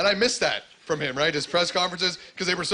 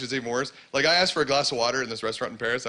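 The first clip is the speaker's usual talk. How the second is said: ordinary talk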